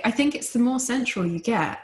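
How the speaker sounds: normal talking voice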